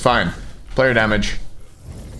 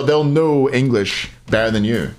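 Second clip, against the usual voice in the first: ordinary delivery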